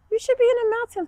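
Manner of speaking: sing-song. Acted. Kids yoga, sing-song cue.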